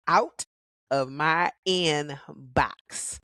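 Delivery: usual speaking voice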